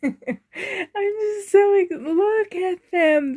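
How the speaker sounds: laughing through tears, slow; choked up and giggling; tearful laugh. Real, not acted. Overwhelmed seeing her doll room.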